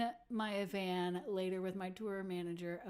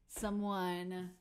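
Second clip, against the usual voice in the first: ordinary delivery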